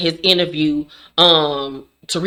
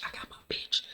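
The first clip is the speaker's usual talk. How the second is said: barely breathed, even fainter whisper; 19 dB quieter, faster than usual. Retold or imitated. Kane savoring his win, barely breathed whisper. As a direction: let it fade to a breath.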